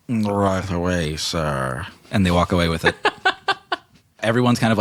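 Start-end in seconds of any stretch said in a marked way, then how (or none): 0.1-1.8 slow deferential butler voice; bow and answer; servant reply. Acted. Servant answering Snack, slow deferential. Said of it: "they just go".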